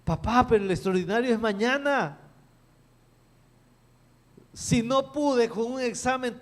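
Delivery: high, loud pleading son's voice; a son begging his dad, high and loud; distressed son. Retold or imitated. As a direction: play the panicked boy who can't cope. Son despairs before the exam.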